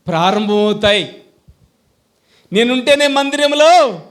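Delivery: loud, high, bragging mock voice of a show-off believer; boastful churchgoer. Preacher mocking a boastful choir singer, loud and bragging. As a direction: ham up the braggart who thinks the church runs on him.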